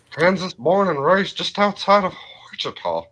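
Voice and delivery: Hank Hill. Acted. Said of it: "doing a little bit of a Hank".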